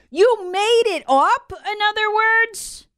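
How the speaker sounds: high, slow, drawn-out disbelief; mocking high exclamation; incredulous. Real, not acted. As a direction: stretch it out in outrage. Indignant punchline on Fauci quote.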